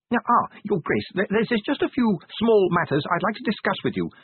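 high-pitched, hesitant clerk; small, high-voiced bureaucrat with a request; fussy little official. A narrator's reading. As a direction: stammer politely in a high voice.

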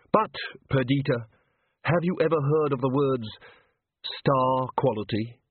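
deliberate, precise character voice; Walter, controlled and measured. Acted. Walter speaks in his new controlled manner.

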